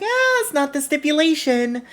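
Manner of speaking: high-pitched dismissive brush-off. Acted. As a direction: wave it away in a high voice. Acted promoter brushes off the claim.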